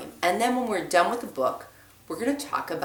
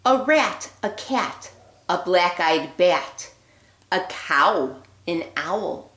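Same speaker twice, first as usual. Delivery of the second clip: put-on lively read-aloud voice for children; storybook reading; 3 dB louder than usual. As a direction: read it like a bedtime story for little ones. Reads picture book aloud.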